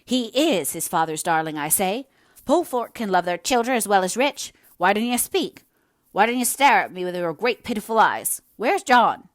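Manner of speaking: loud, high, building to a frantic demand; agitated outburst peaking in a near-shout; gathering in wildness. A narrator's reading. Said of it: "gathering in wildness as she went on".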